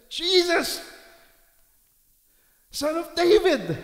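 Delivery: high, loud desperate shout; crying out. Retold or imitated. Woman crying out loud to Jesus for help. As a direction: bellow it from the belly. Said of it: "He cried out, from the bottom of his belly".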